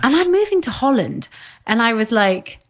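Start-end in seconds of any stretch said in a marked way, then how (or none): 0.0-1.3 high-pitched, excited retold announcement. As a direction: quote her big news, pitch soaring. Retelling client's big news.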